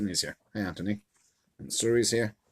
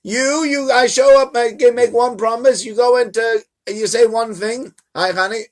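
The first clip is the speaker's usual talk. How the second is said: raised pitch, loud, mock-exasperated scolding; exasperated God; 10 semitones higher, 5 dB louder than usual. God scolds Moshe for complaining, high exasperated rant. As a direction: throw up your hands at the complainer. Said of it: "Rashi says".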